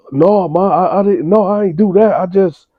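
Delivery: mock a scared son pleading; panicked son. Son denying to mother.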